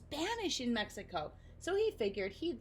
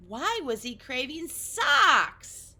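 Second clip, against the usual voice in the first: 1 puzzled louder; 6 semitones higher, 11 dB louder, much slower than usual. A narrator's reading. Puzzled narration.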